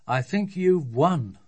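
slow, drawn-out defeat; resigned and slow; hollow, weary father. A narrator's reading. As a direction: concede wearily as a worn-out dad. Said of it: "said her father hollowly".

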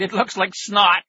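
loud, high, drawn-out taunt; gleeful jeer. A narrator's reading. Brother mocking lettuce up the nose, loud jeer. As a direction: crow it with relish.

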